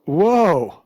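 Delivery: one stunned, acted exclamation; astonished whoa. Bears react to the girl's escape.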